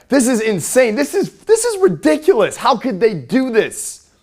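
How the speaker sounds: high-pitched, hammed-up indignant yelling. Shown as a demonstration. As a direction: escalate into loud, drawn-out mock outrage. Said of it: "take it higher".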